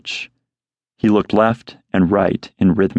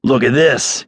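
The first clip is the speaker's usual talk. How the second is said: yelling; 5 semitones higher than usual. Acted. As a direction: yell it across at the bird. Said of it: "he yelled at the buzzard".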